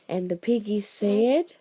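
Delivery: ordinary delivery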